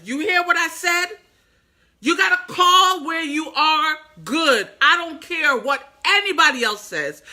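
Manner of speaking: loud, high rallying preach; rally them like a preacher; fired-up preaching. Acted. Inspirational speaker urging her viewers, loud and fervent.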